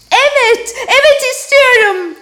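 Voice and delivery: high, loud delighted cry; joyful shout. Acted. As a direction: burst out with joy. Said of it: "diye bağırdı Miranda".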